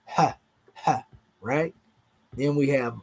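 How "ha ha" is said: Ha, ha breathed out as a voiceless glottal fricative. Shown as a demonstration. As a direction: demonstrate Ha, ha as pure breath. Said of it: "This is a voiceless glottal fricative".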